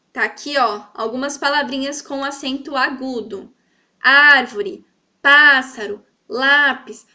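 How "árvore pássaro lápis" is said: Árvore, pássaro, lápis, each first syllable loud; word list with first syllables hit hard: ÁRvore, PÁssaro, LÁpis; stress on accented syllables. Shown as a demonstration. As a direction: punch ÁR, PÁ and LÁ. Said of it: "Vocês viram que onde está o acento agudo".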